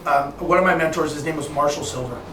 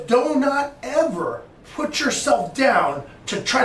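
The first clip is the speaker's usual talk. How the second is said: high, slow and emphatic; firm admonition; 7 semitones higher, slower than usual. Retold or imitated. Mentor schooling mentee on putting himself down, firmly. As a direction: lay down a rule, word by word.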